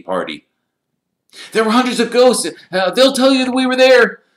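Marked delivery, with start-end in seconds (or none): 1.5-4.2 very high, loud, hurried-sounding excuse; urgent protest. A narrator's reading. Pupils explaining the party to teachers, loud and urgent. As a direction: launch into the alibi urgently.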